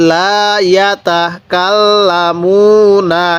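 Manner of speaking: লা ইয়াতা কাল্লামুনা drawn out very slowly, double l in কাল্লামুনা held; slow recitation. Shown as a demonstration. Teacher modelling slow Arabic recitation. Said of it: "আমার সাথে সাথে পড়ুন".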